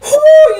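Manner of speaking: loud high whoop of amusement. Real, not acted. Loud whoop after the clip. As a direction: let out a whoop laughing.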